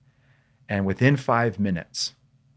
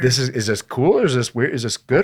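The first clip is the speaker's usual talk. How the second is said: higher, louder worried questions; wondering if they are being set up, in higher, louder worried questions; anxious rapid doubts; 5 semitones higher, 5 dB louder than usual. Retold or imitated. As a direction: pile up the nervous questions. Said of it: "I'm like".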